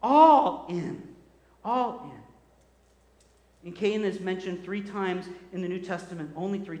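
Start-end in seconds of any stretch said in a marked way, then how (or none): 0.0-1.1 louder, ringing out the words All in; a bold declaration of All in; emphatic call. Shown as a demonstration. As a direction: proclaim All in with force. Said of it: "am I in or not?".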